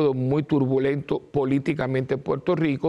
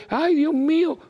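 mock panic; 13 semitones higher, 4 dB louder than usual. Mocking a panicking person.

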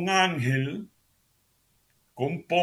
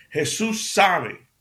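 Jesús sabe drawn out slowly, stressed; emphatic slow; 6 semitones higher, 6 dB louder, much slower than usual. Real, not acted. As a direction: stretch Jesús sabe out loud. (Jesús sabe, lento y enfático)

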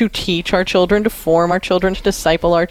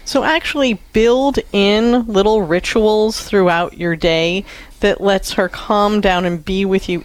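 ordinary delivery

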